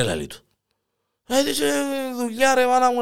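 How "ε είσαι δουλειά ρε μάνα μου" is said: sheepish husband. Retold or imitated. Husband's excuse, voiced high.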